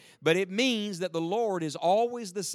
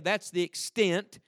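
normal talking voice

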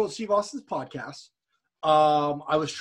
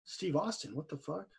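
hushed, under his breath; almost silent private disbelief; muttered to himself; 14 dB quieter than usual. Retold or imitated. Telling friends his inner thought, hushed. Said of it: "I'm thinking to myself".

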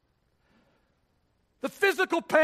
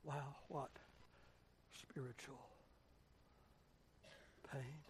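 hushed awe; 10 semitones lower, 25 dB quieter, slower than usual. Real, not acted. Awed hush on spiritual pain.

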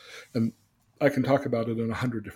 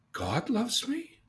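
God loves me rising much higher, very slow; wondering question; 11 semitones higher, much slower than usual. Acted. Boy wondering if God loves him, slow surprise.

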